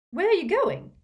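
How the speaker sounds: high, loud startled question; alarmed cry. Acted. Tonks and Fleur, startled, ask where Lupin goes: high alarmed cry. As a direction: blurt it out in alarm.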